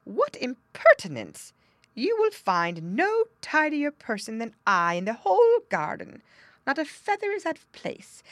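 high, loud prissy bird voice; indignant wren. Acted. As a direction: puff up like a vain little bird.

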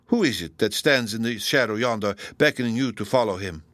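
ordinary delivery, nothing marked

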